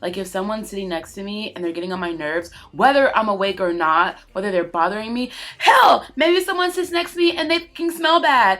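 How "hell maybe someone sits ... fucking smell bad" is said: high, loud indignant outburst; worked-up loud complaint; loud rant. Real, not acted. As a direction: blow up over the smell. Ranting about a smelly seatmate.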